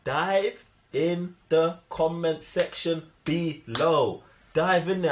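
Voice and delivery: slow, drawn-out sign-off call to action; vlog outro call. Acted. Host urging viewers into the comments, slow and drawn out.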